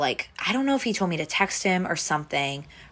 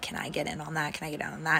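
mock whisper; 9 dB quieter, much faster than usual. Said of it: "was, like, whispering that to me".

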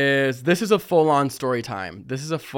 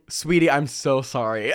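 ordinary delivery

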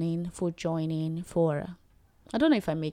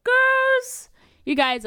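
loud, much higher cheer of praise; excited cheer; 5 dB louder, much slower than usual. Real, not acted. Cheering the girls on, a loud excited shout.

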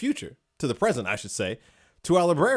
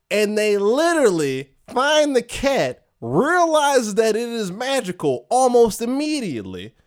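high, loud disbelieving recap; incredulous excitement; 9 semitones higher, 5 dB louder than usual. Real, not acted. Podcaster recapping the cat barter to a co-host, incredulously excited. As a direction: pitch it way up, drawing out the absurdity.

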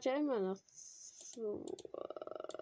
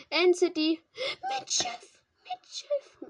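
high, loud excited yell; excited shout; 11 dB louder, slower than usual. Real, not acted. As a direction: shout out the lucky find. Spots End City with ship. (aufgeregter Schrei)

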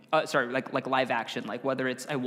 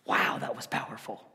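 awed hush; 4 semitones lower than usual. Retold or imitated. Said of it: "she goes".

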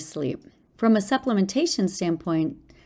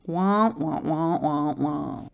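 muffled wah wah talk; Charlie Brown's teacher; 3 semitones lower, slower than usual. Pretend. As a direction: mimic a muffled trombone teacher voice. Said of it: "sounding like Charlie Brown's teacher".

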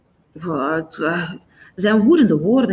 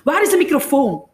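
loud, high angry demand; raised voice, furious; furious call; 8 semitones higher, 6 dB louder than usual. A narrator's reading. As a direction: shout it urgently and angrily. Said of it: "roept ze woedend".

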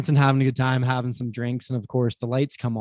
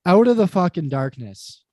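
ordinary talk

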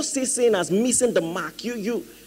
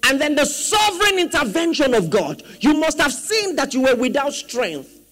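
raised, emphatic sermon voice; forceful preaching; 5 semitones higher, 4 dB louder than usual. Real, not acted. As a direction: drive each point home to the congregation.

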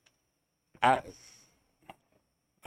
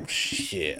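muttered curse; 8 semitones lower, 6 dB quieter than usual. Retold or imitated. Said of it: "And I'm like".

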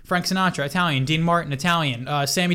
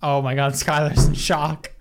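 mock shock; 2 semitones lower, slower than usual. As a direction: draw out each word in hammed-up disbelief. Feigned shock at the news.

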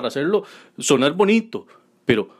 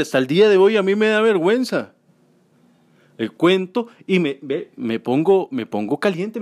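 ordinary delivery

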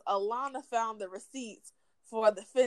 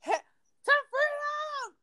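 Harriet Tubman rallying cry; slower than usual. Pretend.

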